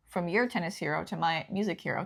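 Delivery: ordinary talk, nothing marked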